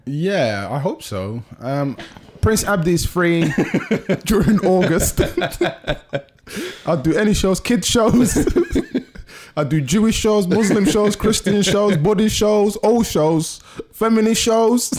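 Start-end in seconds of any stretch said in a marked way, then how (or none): 2.4-5.2 playful hammed-up pitch, laughing through it; mock announcement. Comedian jokingly pitching his act to the host, hammed-up and laughing. 6.9-14.9 high, loud, laughing list; mock sales pitch. Comedian hamming up how desperate he is for gigs, laughing. Jokey list of shows he'll do.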